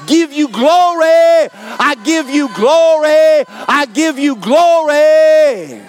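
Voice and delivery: high, loud, drawn-out shouted praise; preaching at full force. Acted. Preacher leading the church in praise, shouting high and slow. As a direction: shout the glory up to the rafters.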